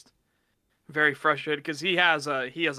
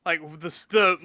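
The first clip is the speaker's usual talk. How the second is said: ordinary talk, nothing marked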